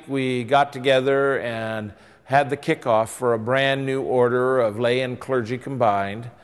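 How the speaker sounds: ordinary delivery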